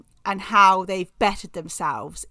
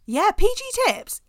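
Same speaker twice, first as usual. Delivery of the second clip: mock surprise; 12 semitones higher than usual. Real, not acted. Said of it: "mock surprise".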